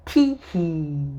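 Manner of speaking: spelt-out private chuckle, lowish; a slow, sly little tee-hee; chuckling to himself. A narrator's reading. Mister Happy has an idea, chuckling to himself. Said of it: "chuckled to himself".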